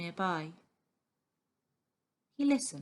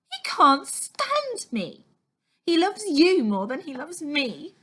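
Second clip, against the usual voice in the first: bitter complaint; 8 semitones higher, 6 dB louder than usual. A narrator's reading. Girl jealous of father's love.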